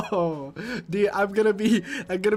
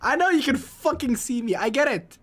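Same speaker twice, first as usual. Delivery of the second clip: higher, louder annoyed snap back; louder, annoyed comeback to viewers; exasperated retort; 6 semitones higher, 5 dB louder than usual. Real, not acted. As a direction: fire it back at the chat, fed up.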